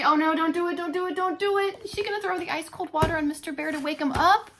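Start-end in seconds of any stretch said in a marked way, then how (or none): none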